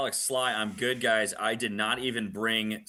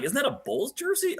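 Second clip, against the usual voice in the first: surprised. Retold or imitated. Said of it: "I was like".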